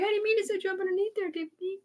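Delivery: soft, high-pitched imitation; imitating a woman. Quoting the sweet one who cries with Tiffany, imitated in a soft high voice. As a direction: put on a small, high voice for her. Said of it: "She's like".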